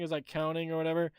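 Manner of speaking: ordinary talk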